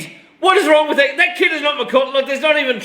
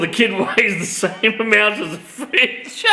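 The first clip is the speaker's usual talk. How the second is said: ordinary talk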